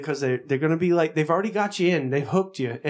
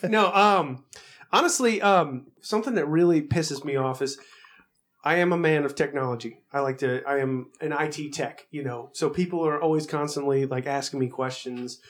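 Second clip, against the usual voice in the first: normal talking voice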